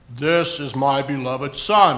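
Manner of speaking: low, slow try at a booming God voice that doesn't land; failed big voice. Shown as a demonstration. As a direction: reach for a big voice but fall short. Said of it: "I'm trying to do a big voice".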